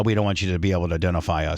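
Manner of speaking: a touch of a whiny masked protester; mocking the protesters. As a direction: rattle it off like a sneering protester. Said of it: "They're all just".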